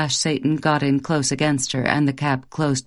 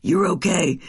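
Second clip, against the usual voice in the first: soothing. Acted. Husband soothes woken wife.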